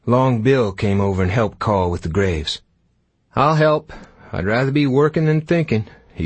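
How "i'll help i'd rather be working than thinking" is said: raised cowhand voice. Acted. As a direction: pitch it up as a nervous young ranger. Long Bill voiced in story.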